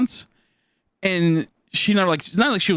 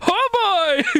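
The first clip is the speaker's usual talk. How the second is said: laughing exclamation; 12 semitones higher than usual. Real, not acted. Laughing at the raid.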